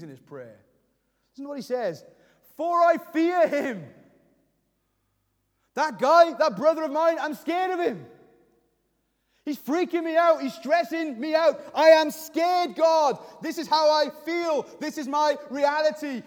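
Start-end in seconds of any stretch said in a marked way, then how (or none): 2.6-3.9 loud, high, drawn-out cry to God; slow, impassioned confession of fear; raw, emotional prayer. Acted. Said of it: "He's raw and real about his feelings". 5.7-16.2 high, forceful outpouring to God; impassioned preacher acting out panic; emotional, scared prayer. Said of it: "he puts some emotion in his prayer".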